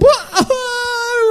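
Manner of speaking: sudden high-pitched wail; wailing cry. Retold or imitated. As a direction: break into a high, wailing sob, retelling the moment. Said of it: "I was, like, crying and wailing".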